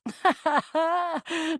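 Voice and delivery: long chuckle; amused laugh. Acted. Reacting to a companion's joke, laughing. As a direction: break into sustained laughter.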